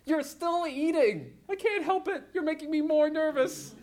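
high, slowed, exasperated; exasperated bicker. Acted. April and Hammond bickering while March speeds, exasperated. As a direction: squabble in panic.

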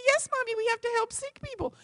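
put-on high, loud childlike agreement; eager obedient child; little girl voice. Little girl dutifully answering her mother, high and eager.

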